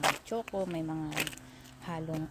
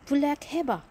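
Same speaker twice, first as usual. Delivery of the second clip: put-on "Pulek heba" in a Korean accent, higher and louder; 8 semitones higher, 7 dB louder than usual. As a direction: imitate the Korean lady ordering. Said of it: "inutusan niya ako".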